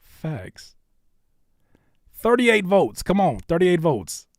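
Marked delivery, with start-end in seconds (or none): 2.1-4.3 loud, insistent call, slowing; rally the chat loudly; calling for votes. Real, not acted. Host pushing chat to thirty-eight votes, calling out.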